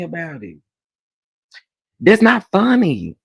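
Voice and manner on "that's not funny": louder, higher That's not funny; indignant protest. Real, not acted. Telling laughing viewers off, loud indignant protest. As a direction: insist it's not funny, raising your voice.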